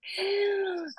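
a sharp, high mock gasp of horror; mock shocked gasp. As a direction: gasp as if the question were absurd. Asked if she's pregnant.

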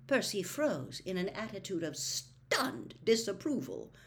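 ordinary delivery, nothing marked

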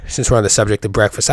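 ordinary delivery

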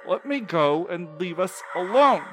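desperate yell. Acted. Milo, fighting off the creature, yelling at it and to Nick. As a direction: scream at the thing and order the pull.

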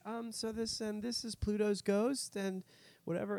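put-on high, slow, halting nervous girl voice; timid high schooler reading her lines slowly; nervous teen guide. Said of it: "She's like".

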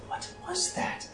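put-on high, soft girlish coo; high-pitched young admirer; excited young woman. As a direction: fawn over something tiny and cute in a high voice. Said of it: "younger woman. She's probably in, like, her nineteen".

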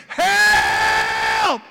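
long, high shout; full-voiced cry for rescue; yelling for help. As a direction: holler it out, drawn out. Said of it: "As he yells".

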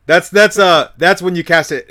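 high, loud, laughing; excited laughing. Real, not acted. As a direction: blurt it out excitedly.

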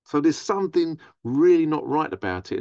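ordinary talk

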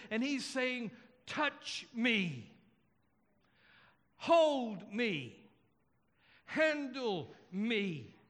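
impassioned preaching. Real, not acted. Preacher declares a touchable Jesus to congregation, impassioned preaching. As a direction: preach it with weight, drawing out the key phrases.

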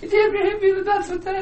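wheezing high cry-laugh; laughing near tears. Real, not acted. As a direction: squeak it out through tears of laughter.

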